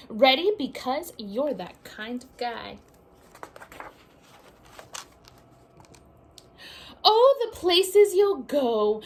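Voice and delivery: lively picture-book read-aloud; sing-song storybook reading; reading to children. A narrator's reading.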